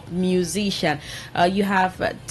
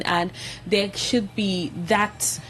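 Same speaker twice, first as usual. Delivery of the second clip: ordinary talk, nothing marked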